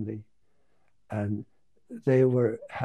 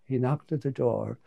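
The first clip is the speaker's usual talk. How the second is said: usual speaking voice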